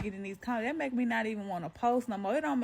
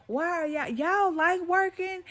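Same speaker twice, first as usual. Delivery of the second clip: incredulous; 9 semitones higher, 4 dB louder than usual. Real, not acted. Baffled at commenters.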